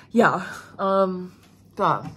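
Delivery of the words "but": ordinary talk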